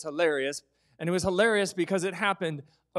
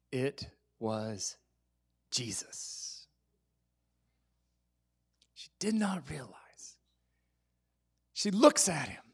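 awed whisper; 4 semitones lower, 3 dB quieter than usual. Acted. Preacher to congregation, awed hushed whisper. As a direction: whisper it, slow and soft.

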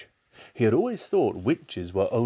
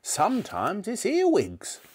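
put-on high old-woman voice; relishing grandmother; 12 semitones higher than usual. A narrator's reading. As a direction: savour the treat as a horrid granny. Grandmother relishing disgusting food.

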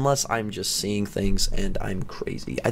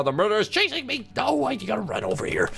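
panicked fleeing; 9 semitones higher than usual. Real, not acted. Fleeing the murderer in game.

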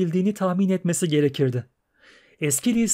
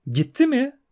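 high, startled question; surprised mermaid; 10 semitones higher than usual. Acted. Mermaid startled that her prince left, high surprised question. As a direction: gasp it out in disbelief. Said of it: "şaşkınlıkla sordu".

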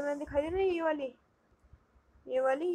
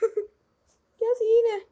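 amazed reaction; 8 semitones higher, 8 dB louder, much slower than usual. Real, not acted. Reacting to the odd train gap.

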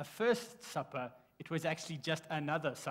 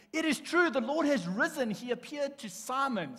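high, loud exclaiming of the news; joyful announcement; 9 semitones higher, 6 dB louder than usual. Acted. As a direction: burst out with the good news to the two. Disciples announcing the resurrection.

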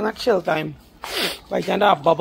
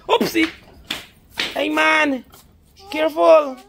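high alarmed call; 6 semitones higher than usual. Real, not acted. Calling out to toddler.

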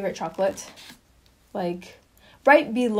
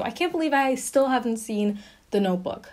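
ordinary delivery

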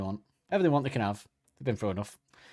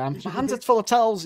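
flustered butler; 10 semitones higher, 4 dB louder than usual. Pretend. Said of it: "He's there".

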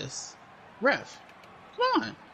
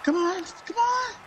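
shouting; 10 semitones higher than usual. Real, not acted. Urging her wrestler on.

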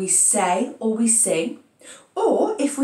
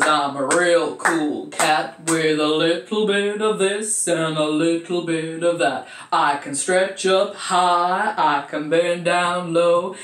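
loud put-on chanting voice; put-on silly voice; 3 semitones lower, 4 dB louder, slower than usual. Shown as a demonstration. Teacher performs rhyme in a voice of choice, put-on voice. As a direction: chant the rhyme loudly in a funny character voice. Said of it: "I know what I'm going with".

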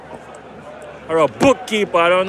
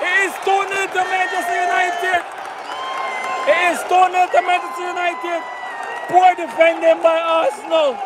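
football commentary; 10 semitones higher than usual. Acted. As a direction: shout it like a stadium commentator. Live match play-by-play call.

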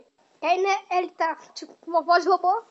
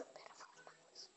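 barely audible voiceless whisper; 38 dB quieter than usual. Real, not acted. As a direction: whisper it close to the mic. Repeats the request into the mic. (sussurrando bem baixinho)

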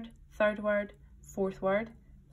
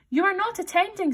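high, loud reprimand; severe; 11 semitones higher, 5 dB louder than usual. A narrator's reading. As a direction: scold severely with the voice pitched up.

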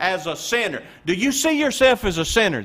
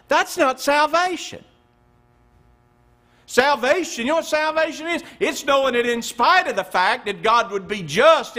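higher, louder preaching that builds; fervent preaching; 5 semitones higher, 5 dB louder than usual. Acted. Preacher to congregation, rising to fervent preaching. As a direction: press the good news home to the congregation.

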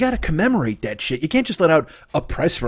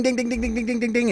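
soft bell imitation; 10 semitones higher, 5 dB quieter than usual.

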